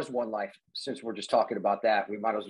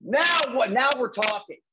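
high, louder burst of excitement; retold thrill, pitched up; excited reaction; 11 semitones higher, 4 dB louder, slower than usual. Host recalls seeing the guest's credits, excited. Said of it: "I'm seeing all your credits".

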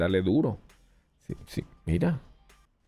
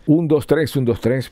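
raised, punchy mic-check count; louder, higher count for a sound test; 6 semitones higher, 5 dB louder than usual. Real, not acted. Testing the sound level.

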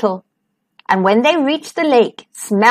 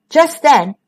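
ordinary delivery, nothing marked

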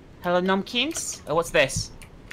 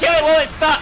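loud, raised-pitch order; urgent shout; 7 semitones higher, 5 dB louder than usual. Real, not acted. Player urging team on in a fight, urgent shout. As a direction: yell to the team to attack.

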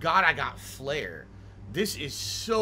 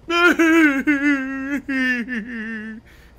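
crying; 8 semitones higher, 8 dB louder than usual. Real, not acted. Player moved by a friend's goodbye in the game, crying. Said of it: "Cry like a baby".

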